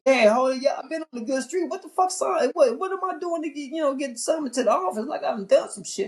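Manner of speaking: very high, incredulous complaint; high-pitched indignant self-questioning; baffled protest. Retold or imitated. Acts out his baffled inner protest.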